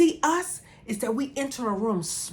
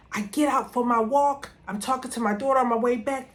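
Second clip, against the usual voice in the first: ordinary talk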